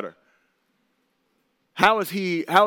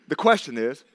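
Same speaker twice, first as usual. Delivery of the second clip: ordinary talk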